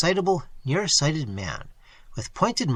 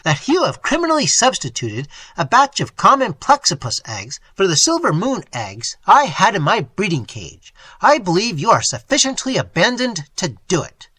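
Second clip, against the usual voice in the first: loud, higher accusation; rage; 5 semitones higher, 6 dB louder than usual. A narrator's reading. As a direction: accuse him, shaking with anger. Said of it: "trembling with rage".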